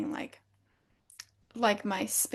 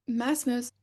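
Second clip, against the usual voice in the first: normal talking voice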